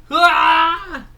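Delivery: high, loud toy shout of pain; a sudden yelled ah as the hit lands; battle cry. Acted. Toy figure cries out while being beaten up, loud high shout.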